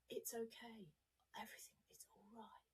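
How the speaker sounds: whispered reassurance, very soft; calm yourself like a baby, whispered; soothing whisper. Shown as a demonstration. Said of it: "and say".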